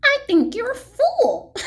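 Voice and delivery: high, loud jeer through laughter; mocking laugh. A narrator's reading. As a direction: laugh right in his face. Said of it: "laughed the sour kangaroo".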